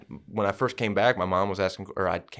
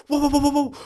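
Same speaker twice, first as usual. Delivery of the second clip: high-pitched, rapid run of whoas; high warbling string of whoas; imitated wobbling whoa; 4 dB louder, much faster than usual. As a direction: wobble way up high like energy spiking. Acting out peaking energy swings.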